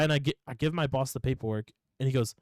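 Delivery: ordinary talk, nothing marked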